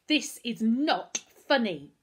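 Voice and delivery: loud, slow, word-by-word outburst; angry shout. A narrator's reading. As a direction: shout it, hammering each word. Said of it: "Darcus found himself shouting".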